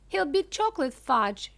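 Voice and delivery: high, loud shriek. A narrator's reading. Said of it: "shrieked Missus Gloop".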